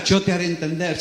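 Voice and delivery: reply calmly as God. Acted. Pero dice Dios.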